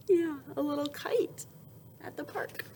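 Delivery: soft whisper pointing at the page. Acted. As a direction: hush it to the children. Whispering to kids about picture.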